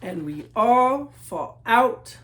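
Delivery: slow, emphatic final refrain; slow closing line. A narrator's reading. Closing line of the picture book, slow final refrain. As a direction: land the ending slowly and gladly.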